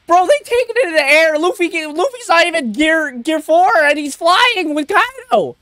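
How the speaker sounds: high, breakneck excited commentary; real thrilled reaction; hyped excitement. Real, not acted. Reacting to fight in the air.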